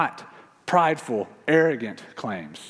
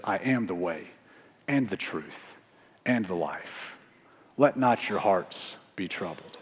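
soft, tender quoting; 5 semitones lower, 6 dB quieter than usual. Acted. Voices Jesus' comforting words.

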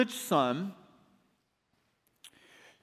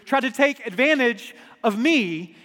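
retold scream; 5 semitones higher, 6 dB louder than usual. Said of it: "She screams out".

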